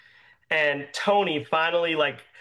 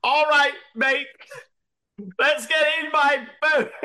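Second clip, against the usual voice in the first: high, hammed-up Australian accent; shaky attempt at Australian, pitched way up; 11 semitones higher than usual. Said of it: "Are you Australian?".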